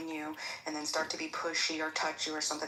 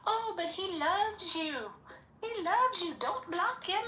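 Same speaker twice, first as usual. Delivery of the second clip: high, slow mock plea; mocking pleading voice; 11 semitones higher, slower than usual. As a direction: ham up a wheedling plea.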